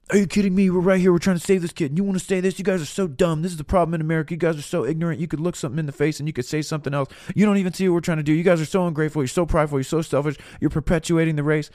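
the furious comeback he held back; angry tirade. Pretend. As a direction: let loose on the kids you would have scolded. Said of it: "tee off on those kids".

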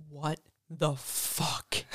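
slow, hushed and stunned; retold stunned reaction, slow and soft; stunned disbelief. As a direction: draw it out quietly, word by word. Stunned discovery, quoting herself.

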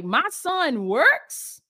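slow, drawn-out disbelief; incredulous father asking; amazed question. Retold or imitated. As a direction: stretch it out, stunned. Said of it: "he was like".

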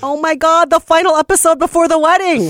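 high, loud gushing excitement; excited squeal. Real, not acted. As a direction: squeal it out with joy. Excited final episode squeal.

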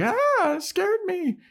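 very high squealed fright; hammed-up squeal of a grabbed girl; startled mock scream. Grabbed girl's scream acted.

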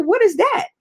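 sudden high puzzled ask; surprised question. Retold or imitated. To Reverend Kim Payton, surprised and puzzled. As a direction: genuinely caught off guard by the name. Asked about the Pink Robe Chronicle.

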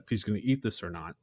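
normal talking voice

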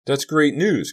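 high, loud delighted exclamation; happy cry. A narrator's reading. Kerry hears Sal is fine, happy cry. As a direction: burst out with relief. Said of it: "Kerry cried happily".

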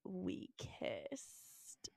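whispered confession; secret whisper. Retold or imitated. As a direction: breathe it like a secret. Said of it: "Sam goes".

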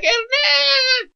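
squealed high hey man, way above his voice; mock girly greeting. Mocking the woman greeting him in a squeaky high voice. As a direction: ham up a big high-pitched girl calling out.

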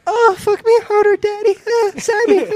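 high-pitched hammed-up moaning; mock female lover. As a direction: play a woman moaning in mock passion. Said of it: "And then she's like".

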